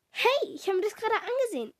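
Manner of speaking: höher, empörter Protest; protestieren, weil das Telefon weg ist. A narrator's reading. (empörtes Hey)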